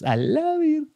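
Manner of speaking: high astonished exclamation; surprised. Real, not acted. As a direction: gasp out in amazement. Reacts to the finished story.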